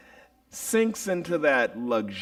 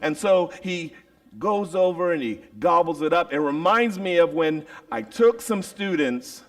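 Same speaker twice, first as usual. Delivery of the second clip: normal talking voice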